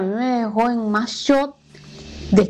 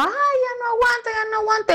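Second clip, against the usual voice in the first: imitated scream; 12 semitones higher than usual. Narrator imitates the beaten neighbor screaming out, high-pitched wail. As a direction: wail it high like a woman who can't take any more. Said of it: "Y ella gritaba".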